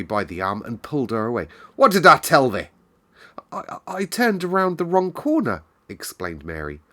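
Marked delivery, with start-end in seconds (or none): 1.7-2.7 cross housekeeper. A narrator's reading. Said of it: "very cross look on her face". 3.5-5.6 high, stammering excuse; flustered girl. A narrator's reading. Mary stammering, caught out.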